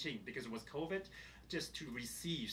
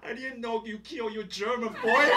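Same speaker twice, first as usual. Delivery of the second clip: louder, higher, through laughter; high laughing quote; laughing while quoting; 8 semitones higher, 13 dB louder than usual. Retold or imitated. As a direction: recount the cousin's line laughing. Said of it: "She said".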